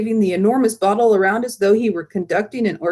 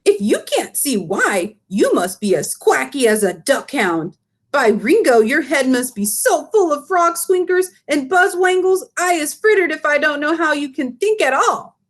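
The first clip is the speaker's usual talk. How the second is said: high, eager giant voice with muddled grammar; the BFG lecturing, indignant; big friendly giant; 5 semitones higher than usual. Acted. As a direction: BFG scolding Sophie, higher and indignant. Giant rants at Sophie.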